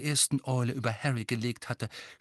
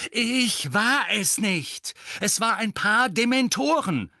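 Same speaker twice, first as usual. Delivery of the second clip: angry shout; 11 semitones higher, 6 dB louder, slower than usual. A narrator's reading. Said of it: "seine Stimme an".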